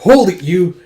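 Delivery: acted guard's challenge; commanding halt. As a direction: stop someone with a sharp order. Character orders suspect to halt.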